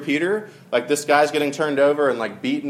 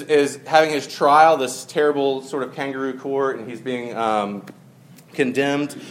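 normal talking voice

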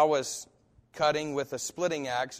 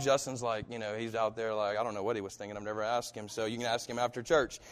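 ordinary talk

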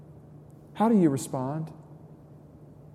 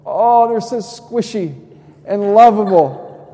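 put-on slow higher coo over the baby; 4 semitones higher, 6 dB louder than usual. Father cooing over his baby girl's chubby cheeks.

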